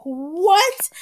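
high, loud shocked "what?"; shocked exclamation. Retold or imitated. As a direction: blurt it in disbelief. Realizes basement is flooding.

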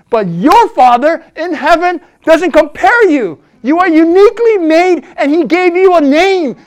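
loud, high preaching, slowing on key lines; full-force preaching. Acted. Preacher exhorting the congregation, loud and rising.

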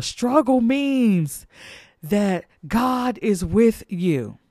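drawn out, each word weighed; slow emphatic preaching. Acted. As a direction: lean on struggle, slowly. Slowed emphatic preaching line.

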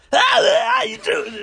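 very high, loud falsetto greeting; high squeaky Terry voice. Retold or imitated. Boxer imitating a strange trainer greeting him, shrill falsetto. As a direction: squeal it like an odd excited old trainer. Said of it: "He goes, "I'm Terry".

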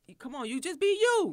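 sudden high, loud pep talk; hyped encouragement. Retold or imitated. Mentor pumping up young girls, high and loud. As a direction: fire the girls up to just be themselves. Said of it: "that's why I give back to talk to these girls".

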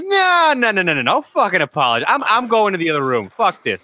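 high mock-outraged dad refusing; imitated angry dad. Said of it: "Then he goes".

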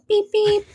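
high chirpy beep-beep like a horn; imitated car horn. To co-host, imitating the smart speaker's car horn, high beeps. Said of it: "And it's like".

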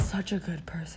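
hushed unvoiced whisper. Real, not acted. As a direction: breathe it as a secret. Whispered aside.

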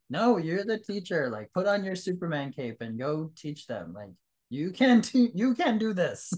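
high, forceful pep talk in a mentor's words; emphatic encouragement. Retold or imitated. Mentor rallying a shy student teacher.

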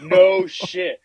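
loud, stunned no shit; reliving the shock out loud; retold disbelief. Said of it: "And I'm like".

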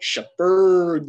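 hammed-up call of Shepard; mock call. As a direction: belt the name out in jest. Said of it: "And he's like".

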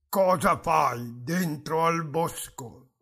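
slow, distant godlike voice; voice of a god. Acted. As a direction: speak as Jupiter from above. Mysterious voice from the woods.

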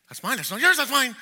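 put-on snatch it like a greedy child; greedy grab. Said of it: "Their whole focus was on".

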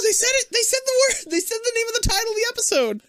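high, fast giddy squeal; mock excitement. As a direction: gush like a thrilled fan. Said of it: "and she goes".

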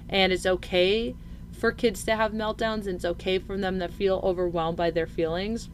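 ordinary talk, nothing marked

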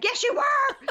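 squeaky high mock retort; arguing back at the husband, high and mock-indignant. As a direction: shoot it back, half-joking indignation. Then we have a huge fight.

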